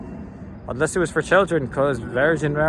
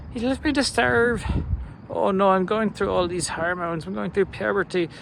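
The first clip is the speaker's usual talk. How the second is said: high-pitched Irish youngster's lament; whining young'un; 5 semitones higher than usual. Acted. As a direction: complain like a put-upon teenager, pitched up. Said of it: "the young'uns would see".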